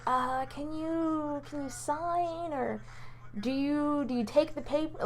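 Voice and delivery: soft, slow, hesitant imitation; flustered, awkward. Classmates awkwardly passing her the attendance sheet, flustered. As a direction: draw out the words as if unsure how to ask. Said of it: "they're so flustered".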